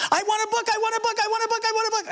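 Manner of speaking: put-on high whiny child's demand. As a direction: whine it like a toddler begging. Child whining for a book.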